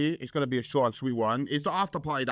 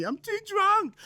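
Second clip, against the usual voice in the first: put-on much higher, drunken plea; drunken whine; slower than usual. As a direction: pitch it way up like a tipsy plea. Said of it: "I'm like".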